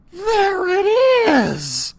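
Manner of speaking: high, loud, drawn-out cry of joy; can't believe your eyes; excited cry. Acted. Boy spotting the treasure pile, ecstatic cry.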